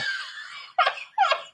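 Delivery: very high, breath-starved laugh; wheezing laugh. Real, not acted. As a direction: wheeze helplessly at your own story. Teller cracks up at own story.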